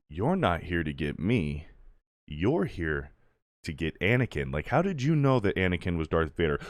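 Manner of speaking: low, soft retold confrontation; quiet imitation of a secretive exchange; whispering. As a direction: keep it hushed, as if through a door. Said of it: "they're, like, whispering to each other".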